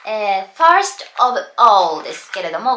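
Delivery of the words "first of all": "first of all" slowly, each word separate. Shown as a demonstration. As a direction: say "first of all" unlinked and deliberate. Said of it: "なんて普通の日常会話で". (「first of all」を一語ずつゆっくり区切って発音)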